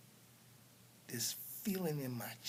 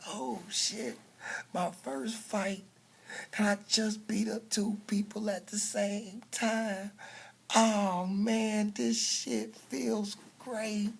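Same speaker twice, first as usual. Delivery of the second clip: thrilled inner thought; 7 semitones higher, 4 dB louder, slower than usual. Retold or imitated. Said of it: "thinking in my mind".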